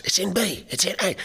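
high-pitched imitation shout, no real volume; mock shouting across. Musician shouting keys across to the saxophonist, mock high shout. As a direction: call it out high, like over a band. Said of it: "shouting the keys across".

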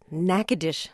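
Natchitoches as NACK-uh-dish; correct local pronunciation. Shown as a demonstration. Host showing the guide he learned it, correct pronunciation. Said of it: "just how to say".

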